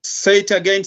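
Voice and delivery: calling out loudly. Real, not acted. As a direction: call out over a bad connection. Can't hear the speaker online.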